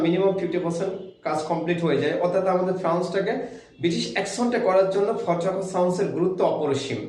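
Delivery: usual speaking voice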